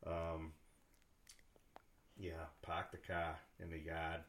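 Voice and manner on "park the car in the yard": dropped R Boston accent, car as "kah", yard as "yahd"; slow Boston demo without the R sounds. Shown as a demonstration. Showing a Boston accent to the interviewer, no R sounds. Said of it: "You have trouble pronouncing the letter R".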